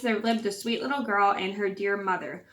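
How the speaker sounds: ordinary delivery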